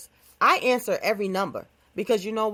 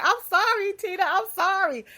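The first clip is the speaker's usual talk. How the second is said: high, whiny mock apology; mock pleading; 10 semitones higher than usual. A haunted friend begs Tina's ghost, high mock pleading. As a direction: beg like a scared victim, hammed up.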